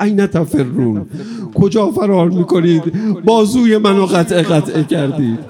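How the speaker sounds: loud, high lament calling after the fleeing; mourning battle cry. Acted. Hussein calling after the enemies who killed Abbas, loud high lament. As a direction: cry out after the cowards in grief.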